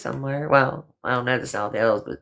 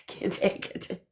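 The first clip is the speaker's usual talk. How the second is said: whispered giggle, higher and softer; can't take it, wheezing with laughter; breathless laughter; 7 semitones higher, 7 dB quieter, slower than usual. Real, not acted. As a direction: laugh so hard the voice goes airless. Breaks up laughing at chat.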